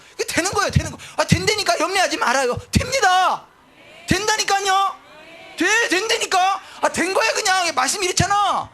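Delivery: high, loud, urgent assurance; emphatic, repeated pulpit insistence; insistent pastor. Acted. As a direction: shout down every doubt. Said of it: "이게 목사라는 거예요".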